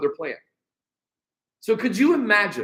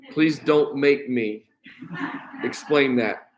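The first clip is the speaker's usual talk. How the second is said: laughing; 5 semitones lower, slower than usual. Real, not acted. Laughing aside after awkward verse.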